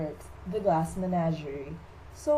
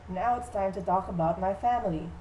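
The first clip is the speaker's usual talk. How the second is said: usual speaking voice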